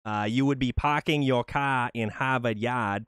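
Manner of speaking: put-on "Harvard Yard" said with a Boston accent, dropping the R's. Joking to viewer Rep that he's from Boston, in Boston accent. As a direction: tease in a thick Boston accent. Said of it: "you could be from, uh, Revere".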